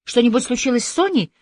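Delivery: high, anxious questioning; alarmed. A narrator's reading. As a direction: demand news with rising worry. Alarmed at news about her friend.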